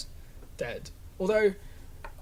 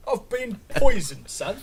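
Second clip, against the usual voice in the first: loud, high mock London outburst; hammed-up London alarm; proper London accent; 6 semitones higher, 9 dB louder than usual. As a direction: complain like a broad Londoner. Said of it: "Just some proper London accent".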